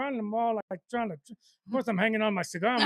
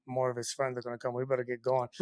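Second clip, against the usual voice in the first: hushed warning; 7 semitones lower, 6 dB quieter than usual. Retold or imitated. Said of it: "I, I go".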